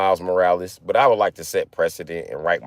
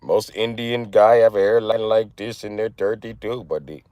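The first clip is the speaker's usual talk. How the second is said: put-on Indian accent. Streamer joking about his hairline to viewers, Indian accent. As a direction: brag in a comic Indian accent. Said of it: "Say it with an Indian accent".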